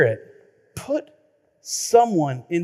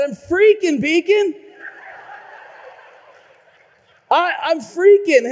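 high, loud mock panic; 10 semitones higher, 4 dB louder than usual. Frantic homeowner pleading with the plumber on the phone, mock panic. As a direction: whine it like a frantic caller.

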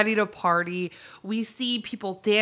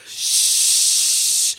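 long hushing shh; crowd shushing; 3 dB louder than usual. Retold or imitated. Party guests hushing each other before the surprise, drawn-out shushing. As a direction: draw out a big shush. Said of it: "all collectively, as a group, go".

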